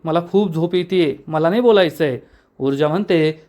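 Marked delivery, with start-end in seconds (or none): none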